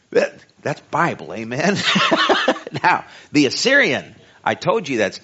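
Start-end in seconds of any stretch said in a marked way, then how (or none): 1.8-2.8 high, loud, breathy burst of laughter; real quick cackle; short laugh. Real, not acted. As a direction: laugh out at your own remark. Laughs after own joke.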